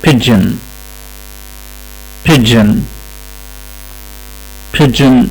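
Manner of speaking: pigeon said slowly as PIDGE-in, soft G like j; teacher models pigeon with the j sound; model pronunciation. Shown as a demonstration. Teacher models the word for students, slow careful pronunciation. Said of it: "كلمة pigeon معناها حمامة".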